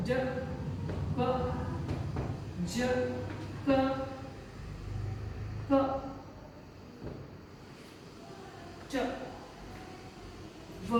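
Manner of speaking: क, च, व sounded short and clipped one by one; short consonant sounds. Shown as a demonstration. Teacher demonstrating short consonant sounds to the class. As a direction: tap out each short sound, ब, ज, क, च. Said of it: "ये इनके short sound होते हैं".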